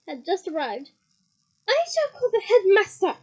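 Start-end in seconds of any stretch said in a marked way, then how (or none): 1.7-3.2 loud stern shout, pitched up. Acted. McGonagall shouting a threat at Peeves. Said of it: "shouted Professor McGonagall".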